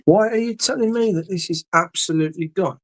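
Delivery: high-pitched, exasperated protest; real, high-voiced exasperation; exasperated disbelief. Real, not acted. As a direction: protest in disbelief that it's lost. Searching in vain for something lost.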